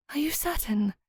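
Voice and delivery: put-on a nervous young woman seeking comfort; Myra, uncertain. A narrator's reading.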